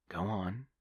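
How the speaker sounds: usual speaking voice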